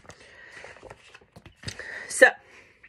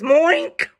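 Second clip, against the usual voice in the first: loud moo-oink blend, raised and rising; botched moo; 5 semitones higher, 5 dB louder than usual. Acted. Cow trying to moo, loud muddled moink. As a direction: jam moo into oink, loudly. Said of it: "instead he goes".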